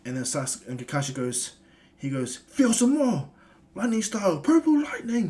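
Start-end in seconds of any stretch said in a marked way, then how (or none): 2.4-5.3 high, loud attack shout; battle cry. Acted. Kakashi blasting Urashiki with lightning, loud high battle yell. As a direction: yell the move name as you strike.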